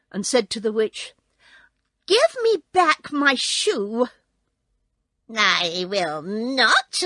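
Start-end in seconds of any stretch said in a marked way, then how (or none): 2.0-4.1 high, loud, slow angry demand; a little girl furiously demanding her shoe. Acted. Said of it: "grew angry". 5.3-7.0 slow, louder witch voice; witch retort. Acted. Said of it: "retorted the witch".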